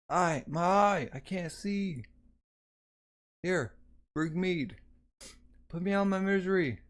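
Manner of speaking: wounded men calling out after the battle; wounded soldier. Acted. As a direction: cry out in agony and beg for mercy.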